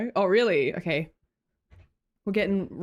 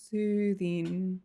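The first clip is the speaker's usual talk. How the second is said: usual speaking voice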